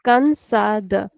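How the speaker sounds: "cansada" split into slow syllables, said twice; broken into syllables. Shown as a demonstration. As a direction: break "cansada" apart slowly. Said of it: "तोड़ तोड़ के पढ़ो इसको".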